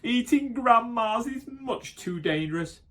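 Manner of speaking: high whiny wolf voice; sorry wolf. Acted. Dazed wolf regretting his meal, high and whiny. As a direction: complain it like a beaten wolf.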